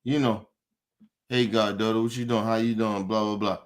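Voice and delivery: lightly voiced sweet check-in call; a hint of a casual caring call; mock caller. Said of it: "call your goddaughter and say".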